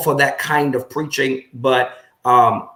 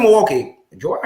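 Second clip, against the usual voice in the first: puzzled surprise; 5 semitones higher, 4 dB louder than usual. Retold or imitated. Retold confusion over the city.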